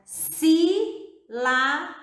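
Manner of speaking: "sílaba" broken into three drawn-out syllables with pauses; teacher sounding out "sílaba" slowly, syllable by syllable; split into syllables. Shown as a demonstration. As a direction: say "sílaba" one syllable at a time. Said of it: "quando você pronuncia a palavra".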